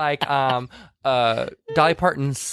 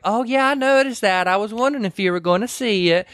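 [name] voice; 9 semitones higher than usual. Retold or imitated. Retelling the movie, a high sweet Dolly voice. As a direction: play Dolly, sugary and high. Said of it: "[name]'s like".

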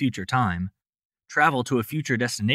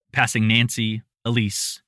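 ordinary delivery, nothing marked

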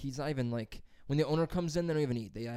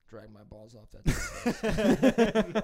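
slow laughing; 5 semitones higher, 9 dB louder, much slower than usual. Acted. Slow laughing vengeful line.

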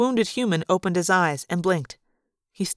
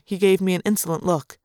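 ordinary delivery, nothing marked

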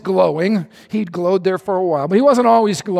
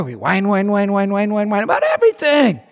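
mock whining. Mocking people whining together.

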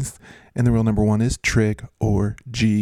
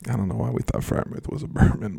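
normal talking voice

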